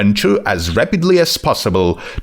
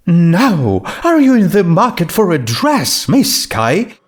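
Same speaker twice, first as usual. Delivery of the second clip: high, grand and gracious; Rarity voice; 7 semitones higher than usual. Acted. Rarity greeting the ghost grandly in a high voice. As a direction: preen a little, lifting the voice high.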